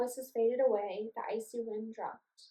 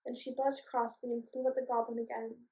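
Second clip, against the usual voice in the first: plain reading voice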